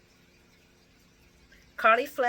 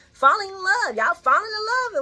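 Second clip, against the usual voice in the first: mocking singsong; 12 semitones higher, 8 dB louder than usual. Mocking the lovebirds.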